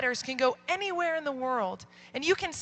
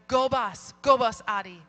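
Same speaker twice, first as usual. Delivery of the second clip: punchy, loud cheer on each "Go"; retold cheer; 3 semitones lower, 6 dB louder than usual. Teacher applauding the girl who dared answer, loud retold cheer. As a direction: cheer her on, loud, then falling. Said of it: "and I go".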